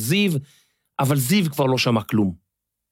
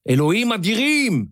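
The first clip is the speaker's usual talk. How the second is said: exasperated shout; 7 semitones higher than usual. A narrator's reading. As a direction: throw up your hands and yell at the sky. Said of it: "צעק משה לעצמו".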